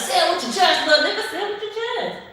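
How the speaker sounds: high, loud, pushing imitation of Kevin Hart; Kevin Hart impression. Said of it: "You know how Kevin Hart used to be like".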